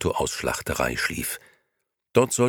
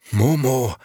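blurted louder and higher between gasps; gasped out; 7 semitones higher, 6 dB louder than usual. A narrator's reading. Beppo out of breath from cycling, blurts out the name. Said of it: "stieß Beppo hervor, der nach Atem rang".

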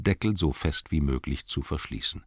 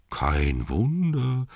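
mocking echo; 7 semitones higher, much slower than usual. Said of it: "sagten sie".

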